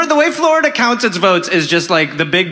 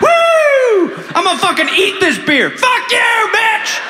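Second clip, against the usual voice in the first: rowdy shout; 14 semitones higher, slower than usual. Acted. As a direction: whoop like a drunk brother. Rowdy brother cheering.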